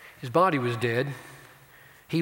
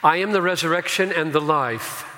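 scripture proclamation; 4 semitones higher, 6 dB louder than usual. Acted. Reads scripture in full voice.

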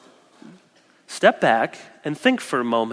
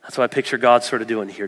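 usual speaking voice

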